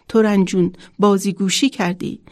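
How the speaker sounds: ordinary delivery, nothing marked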